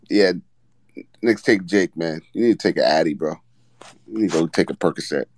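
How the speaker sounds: ordinary talk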